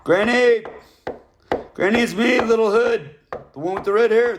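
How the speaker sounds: high, loud holler through a door; calling out loudly. Acted.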